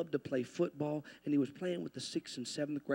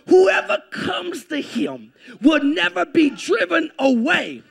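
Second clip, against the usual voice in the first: high, raised voice with drawn-out key words; emphatic preaching; 10 semitones higher, 13 dB louder, slower than usual. Real, not acted. Preacher brings good news of never being driven away, emphatic. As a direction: proclaim the good news phrase by phrase.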